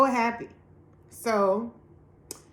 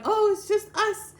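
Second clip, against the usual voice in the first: much higher, light it's just us; imitating the friend, high and breezy; reassuring friend; 12 semitones higher, 4 dB louder than usual. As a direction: soothe a startled host.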